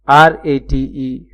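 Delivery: careful dictation pace; slow spelling. Shown as a demonstration. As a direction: slowly spell out आरएटीई letter by letter. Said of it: "जब syllable में एक नहीं दो vowels हों".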